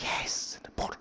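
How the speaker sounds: conspiratorial whisper to mom; a child whispering back to his mother; whispered reply. Retold or imitated. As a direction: hush it like a secret.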